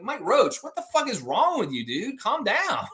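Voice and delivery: high-pitched, incredulous telling-off; mock exasperation. Host telling an upset poster to calm down, mock exasperation. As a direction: tell him off in a high, exasperated voice.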